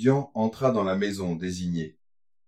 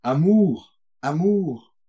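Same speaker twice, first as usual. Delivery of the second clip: high cry, falling away; lyrical exclamation; 7 semitones higher, 5 dB louder, slower than usual. Acted. Coachman lamenting love to a student, lyrical exclamation. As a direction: exclaim it high and loud, letting it drop.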